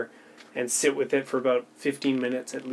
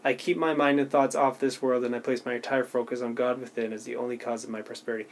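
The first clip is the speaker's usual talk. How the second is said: fast, softer run-through of the sentence; quick reread; 4 dB quieter, faster than usual. Shown as a demonstration. Showing viewers how she rereads it, quick and offhand. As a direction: rattle off the familiar line. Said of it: "I would just reread, like, one sentence".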